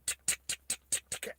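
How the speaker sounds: dry voiceless ticks; quiet, even tongue clicks; imitated clicking. Minigun runs out of ammunition, soft empty clicking.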